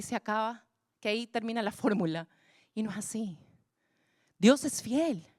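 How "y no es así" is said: whisper. Acted. Preacher whispers correction.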